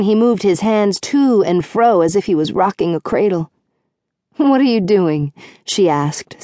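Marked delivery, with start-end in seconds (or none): none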